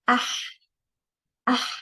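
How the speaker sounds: "ah" closing into a breathed Arabic ha; alif before the ha. Shown as a demonstration. Teacher has learners try the ha, alif before it. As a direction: say "ah" and let it end in h. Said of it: "we're gonna try".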